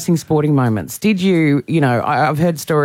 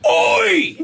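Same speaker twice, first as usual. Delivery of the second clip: high-pitched sideline "oi"; outraged yell. Retold or imitated. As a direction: holler across a soccer field. Re-enacts yelling at the game.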